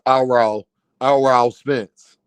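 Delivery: low "Aral Spence", the first name split as "a-ral"; imitated name pronunciation. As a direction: mimic his way of saying "Aral", two syllables, dropped low. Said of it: "how he say his name".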